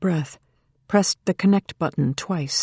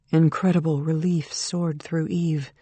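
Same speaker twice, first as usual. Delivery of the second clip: usual speaking voice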